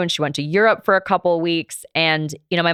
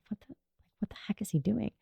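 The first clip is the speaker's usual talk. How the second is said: hushed, breathless whisper to herself; whispered inner thought; 4 semitones higher, 15 dB quieter, faster than usual. Retold or imitated. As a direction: whisper it under your breath, alarmed. Inner panic at police stop.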